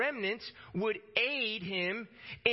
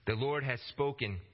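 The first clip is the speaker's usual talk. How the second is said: ordinary talk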